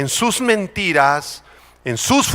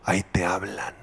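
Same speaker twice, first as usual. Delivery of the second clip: hushed nudge to a neighbour; low, soft whispered tease; whispered aside; 10 semitones lower, 10 dB quieter than usual. Acted. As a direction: whisper it to the person beside you. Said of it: "Dílele junto".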